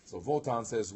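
ordinary talk

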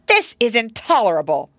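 loud, high indignation; outraged cry. A narrator's reading. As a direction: cry out, affronted. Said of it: "cried the young lady nurse".

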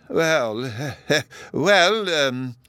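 flustered, with a little chuckle; puzzled hesitation. A narrator's reading. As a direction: stumble for words, pitch jumping up. Puzzled professor, lost for words.

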